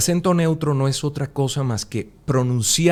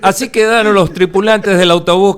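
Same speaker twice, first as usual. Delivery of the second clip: mock sing-song news report, louder and higher; sing-song reporter; 7 semitones higher, 8 dB louder than usual. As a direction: ham up a TV correspondent's chanting delivery. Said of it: "el cantadito".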